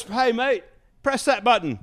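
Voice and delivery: put-on high, loud British accent. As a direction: order it like a chummy English star. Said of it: "He goes".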